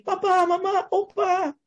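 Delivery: very high imitated wailing baby; high-pitched mock cry for family. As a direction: mimic a baby calling out through tears. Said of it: "Waktu dia nangis, dia hendak bilang gini".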